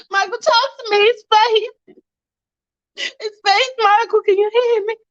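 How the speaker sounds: high, tearful pleading; panicked, whimpering cries for help; acted panic. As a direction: beg him to respond, voice way up high. Panicking over Michael lying still.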